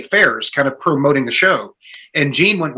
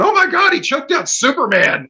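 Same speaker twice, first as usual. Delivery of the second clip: retold awe, pitched well up; amazed; 10 semitones higher than usual. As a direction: gasp it out like you can't believe it. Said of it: "And I'm sitting there going".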